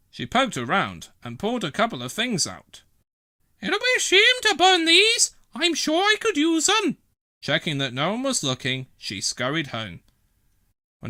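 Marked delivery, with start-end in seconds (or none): 3.6-7.0 put-on very high, louder old lady voice; scheming old lady. Dillis eyeing Bella's rubbish, scheming.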